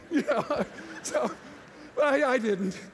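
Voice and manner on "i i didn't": usual speaking voice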